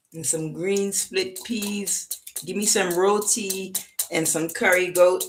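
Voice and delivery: put-on slow Jamaican accent listing foods; tired Jamaican. Reader as a tired Jamaican craving food, Jamaican accent. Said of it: "this Jamaican is tired".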